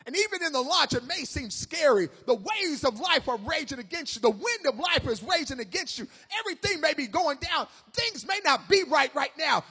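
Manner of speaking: impassioned preacher in full flow; fervent preaching. Real, not acted. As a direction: build the sermon in loud rhythmic waves.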